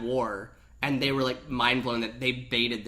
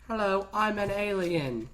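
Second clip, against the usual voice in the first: flat Siri voice; 6 semitones higher, slower than usual. Retold or imitated. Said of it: "It was like Siri".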